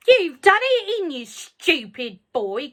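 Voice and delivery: put-on high, loud scolding old woman; shouted. A narrator's reading. Grandma scolding George from the roof, shouting. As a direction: yell down at the boy. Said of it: "Grandma shouted".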